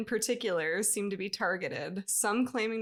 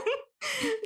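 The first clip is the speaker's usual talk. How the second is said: high laugh. Real, not acted. Big laugh after quote.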